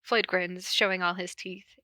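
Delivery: usual speaking voice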